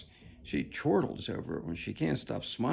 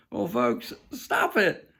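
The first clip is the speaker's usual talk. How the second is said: high, loud exasperated cry to the folks; loud, high-pitched plea of disbelief; exasperated outburst; 11 semitones higher, 7 dB louder, slower than usual. Real, not acted. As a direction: throw up your hands and cry out, stop it. Exasperated appeal to audience.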